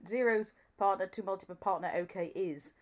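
usual speaking voice

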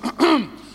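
loud demonstrative throat clear; pointed, acted ahem. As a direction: clear the throat to announce a speech. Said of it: "So I cleared my voice".

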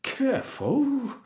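very high, drawn-out startled warning; winded cook's slow yelp; puffing cook. A narrator's reading. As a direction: puff it out after being butted. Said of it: "puffed the cook".

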